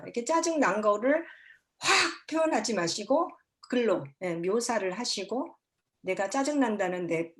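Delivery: ordinary talk, nothing marked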